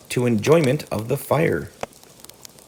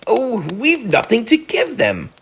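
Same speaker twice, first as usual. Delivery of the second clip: mild despairing cry, raised and slow; 11 semitones higher, slower than usual. A narrator's reading. Mole fretting to Rat about the guests, mild despair. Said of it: "he cried in despair".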